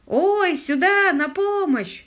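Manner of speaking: high, loud holler; long, carrying high cry; shouting for help. A narrator's reading. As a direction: yell to be found in the dark. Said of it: "снова закричал лорд Питер".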